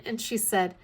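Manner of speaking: ordinary talk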